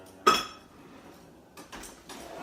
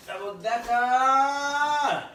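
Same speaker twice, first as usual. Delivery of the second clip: slow, much higher, louder squeal; high excited cry; 11 semitones higher, 6 dB louder, much slower than usual. Pretend. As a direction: shout it out in delight. Sudden high exclaim.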